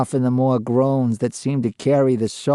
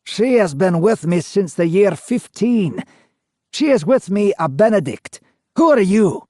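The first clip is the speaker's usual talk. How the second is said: higher and louder, rising annoyance; annoyed ghost; 7 semitones higher, 3 dB louder than usual. A narrator's reading. Ghost turning annoyed at the interviewer. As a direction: build from dreamy to irritated. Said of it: "from almost a dreamy state to one of annoyance".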